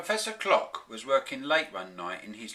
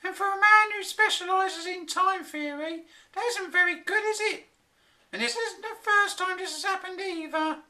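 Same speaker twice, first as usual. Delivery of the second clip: high, louder exasperated scolding; exasperated wife; 6 dB louder than usual. A narrator's reading. Flora scolding her husband about time, high exasperated voice. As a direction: needle him, high and fed up. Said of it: "she sighed".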